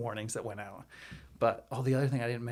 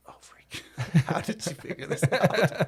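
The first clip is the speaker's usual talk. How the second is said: slow whispered aside with a laugh; hushed giggling mutter; 6 semitones higher, 6 dB louder than usual. Real, not acted. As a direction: whisper it, half laughing in disbelief. Hiding the news from panicking wife.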